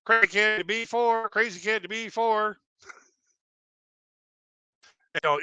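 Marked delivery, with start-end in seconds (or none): 0.1-2.6 higher, announced like a pager call; work page. Retold or imitated. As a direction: call it out over the intercom. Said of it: "it was always like".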